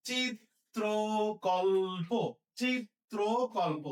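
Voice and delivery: চিত্রকল্প drawn out as chit-tro-kol-po; slow pronunciation demo. Shown as a demonstration. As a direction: stretch চিত্রকল্প syllable by syllable. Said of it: "চিত্র হচ্ছে এইভাবে।". (চিত্রকল্প টেনে টেনে স্পষ্ট উচ্চারণ)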